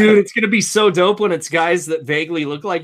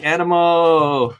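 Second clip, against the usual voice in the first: drawn-out imitation; 3 dB louder, much slower than usual. Imitates someone striking the Animo pose.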